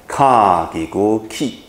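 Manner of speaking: ka ki gu ki drawn out, syllable by syllable; slow spelling. Shown as a demonstration. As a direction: spell it out slowly for learners. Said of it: "第二个字母怎么念".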